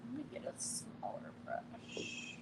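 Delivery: whispered aside; whispering to self. Real, not acted. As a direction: breathe it out in a whisper. Muttering to self while painting.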